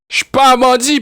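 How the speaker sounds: exasperated outburst. Real, not acted. Exasperated protest.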